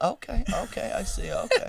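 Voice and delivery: imitating a stoned mom; so calm. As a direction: answer far too calmly. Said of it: "it was so fucking calm".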